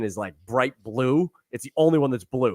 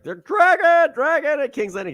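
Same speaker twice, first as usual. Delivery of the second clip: much higher and louder, mock alarm; excited alarm; 12 semitones higher, 5 dB louder than usual. Retelling Aegon hearing of a dragon, high mock alarm. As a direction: blurt it out like a startled prince. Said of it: "he's like".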